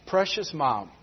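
usual speaking voice

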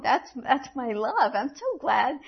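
usual speaking voice

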